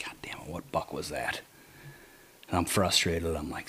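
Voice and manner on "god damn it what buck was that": hushed, unvoiced whisper, much softer; whispered curse. Hunter in a tree above a doe, whispering a frustrated curse. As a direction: curse under your breath so the doe won't hear.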